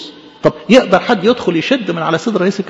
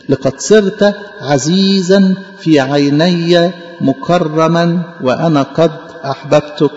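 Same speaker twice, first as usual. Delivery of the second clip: very slow, measured recitation; solemn scripture quotation; 2 semitones lower, much slower than usual. Acted. God telling the believer he is loved, solemn recitation. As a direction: draw each word out with solemn weight. Closes solemnly quoting God's words.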